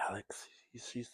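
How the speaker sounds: soft whispered aside about the toy. Real, not acted. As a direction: whisper it like a secret. Showing a toy up close.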